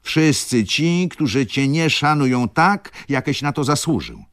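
high, loud, emphatic retort; bold outburst. A narrator's reading. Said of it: "Przestraszyłem się własnej śmiałości". (śmiały wybuch, podniesionym głosem)

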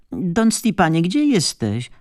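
high searching call. A narrator's reading. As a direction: call up through the house looking for him. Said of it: "zawołała znowu wdowa".

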